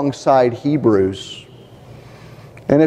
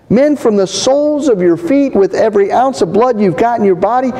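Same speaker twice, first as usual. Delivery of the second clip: fiery coach's pep talk; 6 semitones higher than usual. Retold or imitated. Coach rallying his players before the game, building pep talk. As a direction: fire the team up like a coach before kickoff. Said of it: "this is what he says to them".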